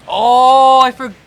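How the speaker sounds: loud, high drawn-out exclamation; sudden outcry. Real, not acted. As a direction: burst out as if just remembering. Commentator exclaims on remembering.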